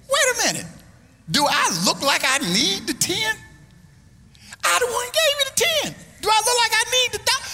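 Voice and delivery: high-pitched hammed-up outrage; comic preacher outrage; mock indignant. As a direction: act offended, voice jumping way up.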